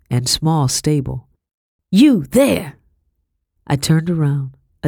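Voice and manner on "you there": sharp call across the yard, louder and higher; a woman hailing a stranger from the kitchen door, loud. A narrator's reading. Woman calls out from doorway.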